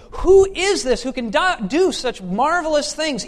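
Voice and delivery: amazed crowd. Acted. Crowds see his healings, loud astonished question. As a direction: marvel out loud with pitch well up. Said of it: "and they say".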